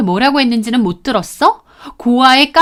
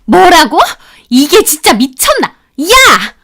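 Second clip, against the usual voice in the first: angry yell; 4 semitones higher, 4 dB louder, slower than usual. Acted. Sister-in-law enraged.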